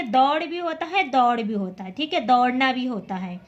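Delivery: ordinary delivery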